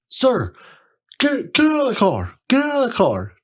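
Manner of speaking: high, loud barked commands; imitating a cop ordering a man out of his car; cop yelling. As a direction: shout the orders like a cop at a traffic stop. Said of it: "who's yelling?".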